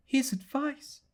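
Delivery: high, wondering echo rising on His; high private murmur, dawning dread; puzzled murmur to herself. Acted. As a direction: turn the words over as if struck by them, lifting at the end. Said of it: "she said to herself".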